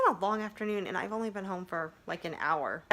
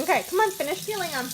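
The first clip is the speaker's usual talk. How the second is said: urging; 9 semitones higher, 5 dB louder, faster than usual. Real, not acted. Mom urging child on.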